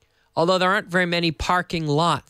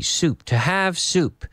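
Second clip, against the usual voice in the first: slow model phrase; 2 semitones higher, slower than usual. Shown as a demonstration. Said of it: "teacher models slowly".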